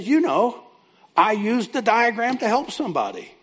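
imitating a thrilled woman, pitched high; excited telling. Counselee telling counselor she helped somebody, excited.

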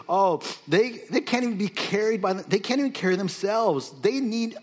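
holy sarcasm. Acted. Preacher voicing God mocking the heavy idols, sarcastic. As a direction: sneer at the helpless idols on God's behalf. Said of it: "a holy sarcasm".

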